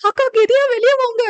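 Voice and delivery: very high, loud, fast shout; desperate boy's cry, shrieked at speed; panicked scream. A narrator's reading. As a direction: yell for a sister to get out now. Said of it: "என தரு கத்திட".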